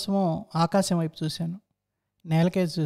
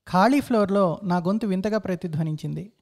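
ordinary delivery, nothing marked

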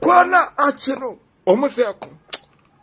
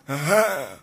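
ordinary talk

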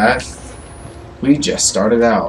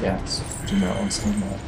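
hushed, much softer coaching; whispering; 3 semitones lower, 9 dB quieter than usual. Real, not acted. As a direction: keep your voice right down. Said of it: "I love how I'm whispering".

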